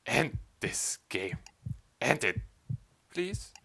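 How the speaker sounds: slow, hollow whisper; whispered plea to end it all; despairing whisper. Acted. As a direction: breathe it out, broken and empty. Broken despair, pleading.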